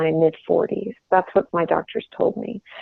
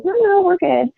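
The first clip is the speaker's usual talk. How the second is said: polite refusal; 10 semitones higher than usual. Retold or imitated. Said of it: "I politely said".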